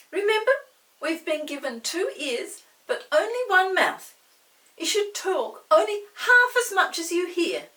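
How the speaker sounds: put-on high, loud lecturing little girl; preachy girl. Molly lectures Chinky, high and preachy. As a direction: lecture him like a prim schoolgirl. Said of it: "said Molly".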